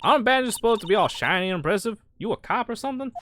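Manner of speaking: put-on lively country Southern accent. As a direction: play a folksy Southern gal. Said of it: "so I could do this accent".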